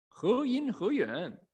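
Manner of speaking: normal talking voice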